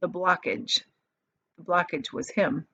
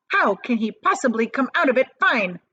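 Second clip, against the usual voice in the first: snapping; 5 semitones higher, 5 dB louder than usual. A narrator's reading. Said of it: "snapped Missus Gloop".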